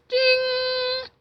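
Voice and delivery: high, ringing "ding"; high sustained bell imitation; imitated microwave ding.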